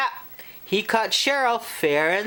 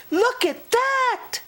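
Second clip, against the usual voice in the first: much higher delighted gasp; surprised girl. Acted. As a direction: spot a treat in wonder. Cheryl sees candy bar.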